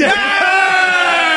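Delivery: long, high "yeah" cheer; drawn-out celebratory shout; triumphant yell. Real, not acted. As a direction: holler in victory, held long. Celebrates guest finally saying it.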